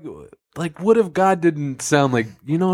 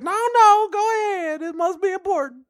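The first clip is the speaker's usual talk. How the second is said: much higher, fake polite; high God voice. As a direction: say it high and put-upon.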